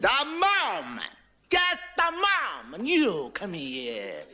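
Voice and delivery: slow, high, drawn-out papal voice; the Pope summoning him with mock grandeur; hammed-up stern pontiff; Pope's exasperated order. Pope losing patience in debate.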